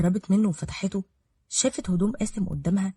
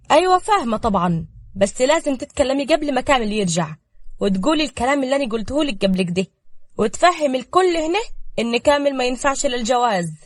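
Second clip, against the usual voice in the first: higher, louder sly instructions; scheming older woman; 5 semitones higher, 5 dB louder than usual. A narrator's reading. Safaa coaches Ruqaya before Kamel returns. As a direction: coach her in the plot.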